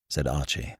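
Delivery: usual speaking voice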